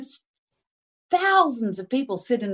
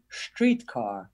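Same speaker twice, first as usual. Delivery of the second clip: put-on Streetcar said lower and softer in a German accent; 7 semitones lower, 6 dB quieter than usual. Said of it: "That was a very German pronunciation".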